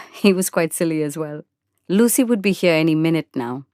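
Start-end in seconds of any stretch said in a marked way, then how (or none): none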